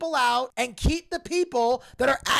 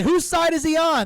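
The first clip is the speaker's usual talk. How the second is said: raised, puzzled commentator voice; a hint of Bobby Heenan, louder; commentator impression; 6 semitones higher, 5 dB louder than usual. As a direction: ask it like a confused commentator. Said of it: "He's like".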